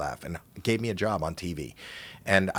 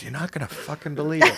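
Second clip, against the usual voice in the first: high-pitched imitated disbelief; lightly hammed-up bafflement, pitched high; mock exasperation; 8 semitones higher, 3 dB louder, faster than usual. Comic plays limping Lou confiding his problem, mock exasperated.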